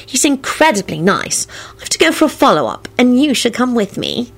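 happy confiding. A narrator's reading. Solange inviting Quentin to her follow-up, happy. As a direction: invite him along eagerly.

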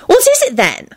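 high-pitched eager question; delighted enthusiasm. A narrator's reading. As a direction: ask brightly, keen to hear. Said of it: "Rosie enthused delightedly".

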